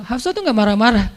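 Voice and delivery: ordinary talk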